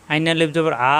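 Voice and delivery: ordinary talk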